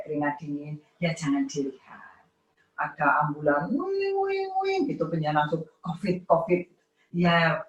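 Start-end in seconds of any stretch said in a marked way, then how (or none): 3.7-4.5 siren. Retold or imitated. Imitating ambulance siren.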